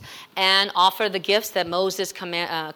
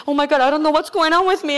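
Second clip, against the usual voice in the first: high, fast, louder whimpering mock voice; imitating Freddie crying; 7 semitones higher, 6 dB louder, faster than usual. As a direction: rush it out like a tough guy who can't stop crying. Pastor voices crying young man.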